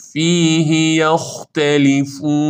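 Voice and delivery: drawn-out recited فیه یختلفون; model recitation. Shown as a demonstration. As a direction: stretch فیه یختلفون with a short "hi". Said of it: "ها فیهی کسرهٔ زیرش یه حرکت".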